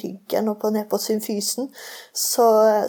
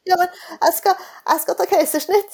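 high, urgent objection; higher-pitched panicked insistence on the planned C-section; alarmed protest; 9 semitones higher than usual. Retold or imitated. Protesting to midwife about plan. (høy, oppskaket protest)

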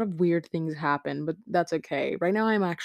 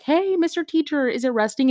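lightly voiced eager student, higher; mock pupil calling out in a higher pitch; student asking; 7 semitones higher, 3 dB louder than usual. Student asking the teacher about a lab, mock questioning. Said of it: "when I was like".

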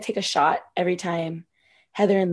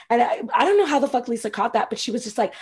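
normal talking voice

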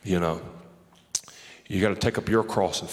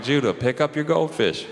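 put-on higher, laughing dad voice telling his kid off; mock dad scolding; 6 semitones higher, 3 dB louder than usual. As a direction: play the amused father nagging his son. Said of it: "I'm like".

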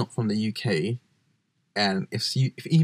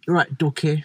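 put-on Midlands accent; 6 semitones higher than usual. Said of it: "people were like".

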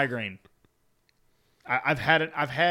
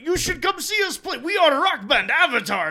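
much higher, louder put-on voices; band members' pitch; 13 semitones higher, 6 dB louder than usual. Band inviting sandwich shop worker to their show, high put-on voice. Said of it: "and they were like".

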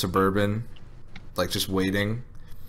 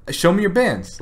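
higher, louder teasing call; mock brother taunting; 10 semitones higher, 3 dB louder than usual. Retelling his brother taunting the drunk guy, higher mock tease. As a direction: goad him to show the money. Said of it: "My brother's like".

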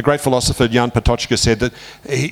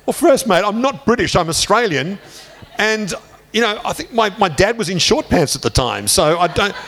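higher-pitched, chatty self-defence; mock-indignant protest; 9 semitones higher than usual. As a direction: protest your innocence, half-joking. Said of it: "And I said".

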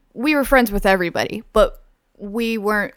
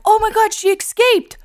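much higher, louder hammed-up panic; mock frantic alarm; 13 semitones higher, 6 dB louder than usual. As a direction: yell at her to run, all fake. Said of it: "we would just be like".

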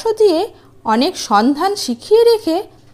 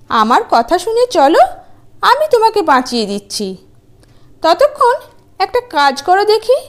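high, sneering and teasing; mocking wife; 6 semitones higher than usual. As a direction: talk down to him with scorn. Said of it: "নাক সিটকিয়ে কুকরোর পানে তাকিয়ে".

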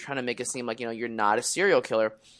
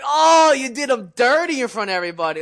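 high, loud hyped-up crowd heckle; teasing crowd; 10 semitones higher, 8 dB louder than usual. Retold or imitated. Coworkers roasting the new hire, loud high heckle. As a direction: ham up the room roasting him. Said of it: "everyone was like".

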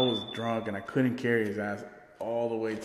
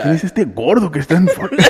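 loud, high, fast Spanish-speaking parent, laughing; angry parent; 5 semitones higher, 13 dB louder, faster than usual. Retold or imitated. Parents find a drunk stranger, loud fast Spanish outburst. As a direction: belt it out like a furious mom. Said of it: "my parents wake up like".

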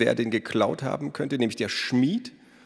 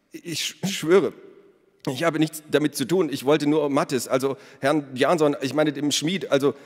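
high, loud, stumbling protest; panicked stammer; 6 semitones higher, 3 dB louder than usual. A narrator's reading. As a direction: swear your innocence in a panic. Baker stammers accused of murder. (der Bäcker stammelt panisch, hoch und laut)

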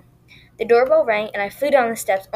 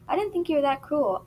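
higher, mildly stung retort; a little offended; 6 semitones higher, 3 dB quieter than usual. A narrator's reading. Girl rebukes her best friend, a little offended. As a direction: push back with slight offence. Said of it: "I spat back, a little offended".

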